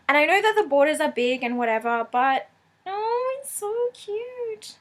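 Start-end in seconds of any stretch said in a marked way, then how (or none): 2.9-4.8 high, soft, slow coo. Real, not acted. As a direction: coo over something adorable. Cooing over cute card.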